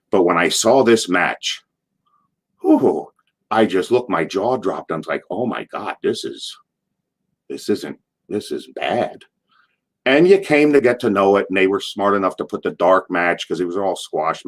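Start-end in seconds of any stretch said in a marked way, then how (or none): 5.3-9.2 retold stunned reaction, under the breath; hushed disbelief. Reaction to bad match, retold.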